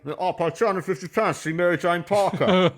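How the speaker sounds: hammed-up outburst from someone barred at the door; pleading latecomer. Acts latecomer disrupting the play.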